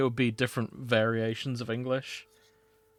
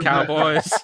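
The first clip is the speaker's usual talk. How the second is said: a hammed-up American cowboy accent, louder at the end; mock American cowboy; 3 semitones higher, 7 dB louder than usual. Friend reading fanfic to mates, hamming a bad American cowboy accent. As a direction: play the American cowboy in a hammy Americanish accent, slipping British. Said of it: "that's the American one".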